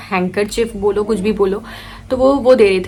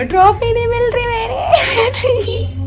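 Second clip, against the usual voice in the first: high, slow crying shout; wailing sob; 13 semitones higher, much slower than usual. Retold or imitated. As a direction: wail it like a heartbroken kid. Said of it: "रो रही हो". (ऊँची आवाज़ में रोते हुए चीखकर)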